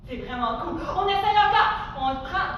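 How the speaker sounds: ordinary talk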